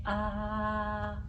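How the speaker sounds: low, soft ah from down low; low ah from the chest. Shown as a demonstration. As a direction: let the ah come from down low. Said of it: "let's begin with our ah".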